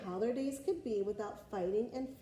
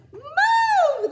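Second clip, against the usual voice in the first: much higher, loud yell; shouting knights; 17 dB louder than usual. Acted. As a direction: bellow at the boy to move. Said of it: "they shouted".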